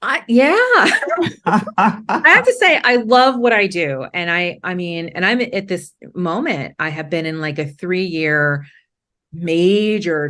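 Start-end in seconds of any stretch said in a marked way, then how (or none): 0.0-4.0 high, loud laughter through the words; bubbling over with delighted laughter; laughing while answering. Real, not acted. Laughing delight at her work.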